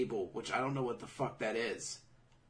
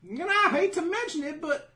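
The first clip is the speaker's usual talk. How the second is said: very high, louder, slowing; mock high voice; 7 dB louder than usual. Recapping a character's report, very high. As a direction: squeak it like a cartoon messenger. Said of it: "the person's like".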